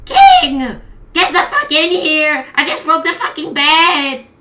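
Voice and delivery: high, loud furious queen shout. Acted. Queen screaming for the king after breaking the bed. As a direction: bellow for the king from the wreckage.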